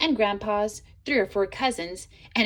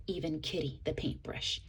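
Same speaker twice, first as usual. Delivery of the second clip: hushed whisper; 6 semitones lower, 12 dB quieter than usual. A narrator's reading. Sad climax of erasing.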